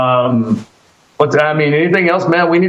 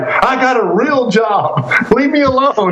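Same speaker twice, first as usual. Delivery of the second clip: high, drawn-out mock-indignant protest; mock mad; 8 semitones higher, slower than usual. Host teasing guest about last year's feud, mock angry. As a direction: imitate a friend's angry outburst, pitched well up. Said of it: "you were so mad at Disco".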